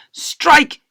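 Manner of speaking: loud, high yell. Acted. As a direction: holler it across the playground. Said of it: "yelled a third".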